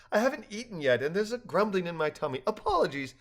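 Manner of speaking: high-voiced sheepish little creature; embarrassed. A narrator's reading. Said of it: "confirming his embarrassment".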